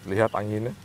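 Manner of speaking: ordinary delivery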